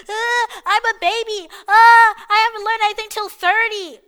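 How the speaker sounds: high, loud fake bawling; wailing mock baby voice; mock crying. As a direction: imitate a crybaby, hammed up. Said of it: "this idiot crying like".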